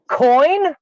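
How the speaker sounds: high, loud puzzled call; puzzled barista call. Retold or imitated. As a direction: call out the odd name like a question. Barista reads mangled name.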